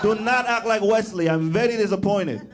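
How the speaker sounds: hammed-up Spanish-accented mom. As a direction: lecture like an offended abuela. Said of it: "she's like".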